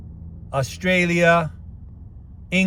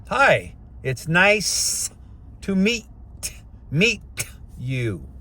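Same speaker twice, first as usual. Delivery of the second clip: slow, over-enunciated greeting, each word separate; careful greeting; 2 semitones higher, slower than usual. Shown as a demonstration. Coaching men to greet someone, slow exaggerated diction. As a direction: spell out "Hi, it's nice to meet you" word by word, very slowly. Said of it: "And it comes out in one roll".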